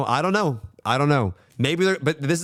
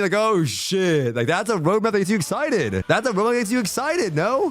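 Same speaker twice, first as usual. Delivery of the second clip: higher and climbing with excitement; excited rising hype; 8 semitones higher than usual. Real, not acted. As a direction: sell the dream, getting more thrilled. Excited over imagined roadmap.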